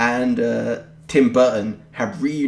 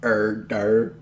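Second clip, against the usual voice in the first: American impression. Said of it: "That's your impression of Americans".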